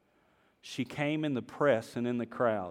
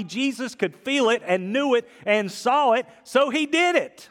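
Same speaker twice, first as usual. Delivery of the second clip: louder and higher, building to a peak; preaching at full force; 9 semitones higher, 7 dB louder than usual. Acted. Pastor building the sermon to a climax, loud and rising. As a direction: drive it home to the congregation.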